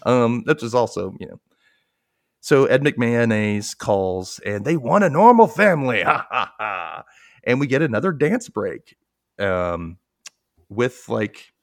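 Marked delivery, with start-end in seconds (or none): 4.6-7.0 hammed-up prize pitch; game-show host impression. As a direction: boom it like a cheesy host. Reprising the host's catchphrase.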